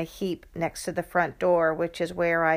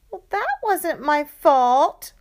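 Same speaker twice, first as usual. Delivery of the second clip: high, louder, slow protest; 9 semitones higher, 6 dB louder, slower than usual. Acted. Sister protesting to mom about lesson costs, indignant. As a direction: defend yourself indignantly. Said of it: "Lexi protested".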